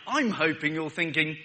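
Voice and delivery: ordinary delivery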